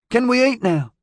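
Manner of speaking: put-on high, whiny child voice; plaintive child. A narrator's reading. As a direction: plead like a hungry kid. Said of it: "Gary asked plaintively".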